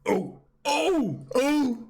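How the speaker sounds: high shocked wailing at the screen; excited cries. Real, not acted. Reacting to dramatic scene.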